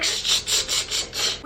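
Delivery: string of sharp hushes; repeated shushing. Retold or imitated. Everybody cutting Meg off, insistent shushing. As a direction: hiss a run of shushes.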